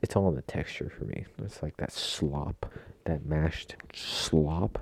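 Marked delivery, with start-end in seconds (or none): 1.4-4.8 slow whispered disgust at slop. Real, not acted. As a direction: drag the word slop out, repulsed. Disgust at mashed potatoes.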